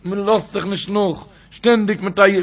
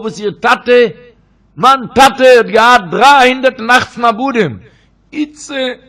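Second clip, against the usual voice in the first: higher and much louder, a woman's desperate cry; 5 semitones higher, 10 dB louder, slower than usual. Retold or imitated. Woman cries out before the sages about her father, loud and high. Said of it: "hat sie geschrien".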